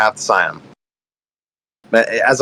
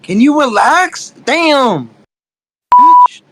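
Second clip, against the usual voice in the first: annoyed outburst; 12 semitones higher, 4 dB louder, much slower than usual. Real, not acted. Snapping back at a heckler.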